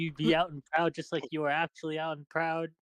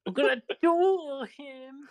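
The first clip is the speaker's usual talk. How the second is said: slow, stretched, higher cartoon line; slow drawn-out quote; 6 semitones higher, much slower than usual. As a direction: drag it out like a cartoon duelist. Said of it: "actual line from the show".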